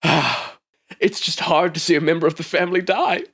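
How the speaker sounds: a lightly voiced, hammed-up sorrowful woman; mock grieving executive. Executive eyeing the severed head, mock mournful. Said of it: "looks at the head ... and goes".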